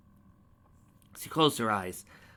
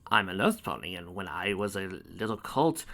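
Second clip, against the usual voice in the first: normal talking voice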